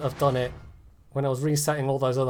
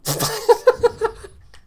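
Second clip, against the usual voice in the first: shrill cackle of despair; exasperated high laugh; 8 dB louder than usual. Real, not acted. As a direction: laugh in disbelief. Laughs at bad guns.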